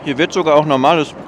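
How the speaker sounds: talking with food in mouth; mouth full. Real, not acted. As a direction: speak through a mouthful. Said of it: "mit vollem Mund isst man nicht".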